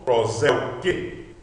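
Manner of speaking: "prós, el, que" run together; one unit of sound. Shown as a demonstration. Teacher reads the compound whole, as one unit of sound. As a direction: join the syllables into one word. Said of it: "lendo tudo numa unidade de som".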